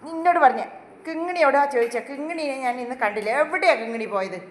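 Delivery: loud pretend threat; mock scolding. Owner pretend-scolding the pet to make it laugh. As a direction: play the strict owner, loud. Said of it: "വഴക്ക് പറയുമ്പോൾ".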